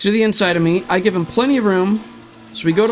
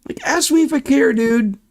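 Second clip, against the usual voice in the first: angry; 6 semitones higher than usual. Real, not acted. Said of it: "Sorry I'm getting this angry".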